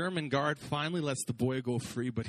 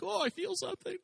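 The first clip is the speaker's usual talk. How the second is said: high, breathy mock unease, much higher in pitch; a spooked squeak at an imagined curse; mock spooked driver. A pretend churchgoer spooked under a power line, mock unease. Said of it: "you're like".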